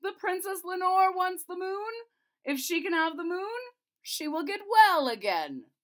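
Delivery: king ordering the Lord High Chamberlain, loud and high; commanding king. Acted. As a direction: demand it like a king. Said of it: "said the king".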